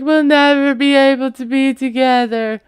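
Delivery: hammed-up weepy plea; mock sobbing. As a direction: whine it like she's crying, half-mocking. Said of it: "she's just hysterically sobbing again".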